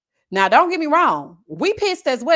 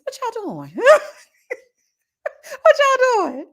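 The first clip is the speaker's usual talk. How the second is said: squealed, higher and louder through a laugh; high mock shock; 11 semitones higher, 4 dB louder than usual. Asking linemen what they're doing, high squeal. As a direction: shriek it at the workers in disbelief. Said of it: "I said".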